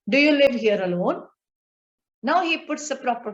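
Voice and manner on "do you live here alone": "Do you live here alone?" loud at the start, rising on "alone"; emphatic question. Acted. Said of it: "he emphatically says".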